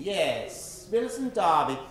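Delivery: ordinary delivery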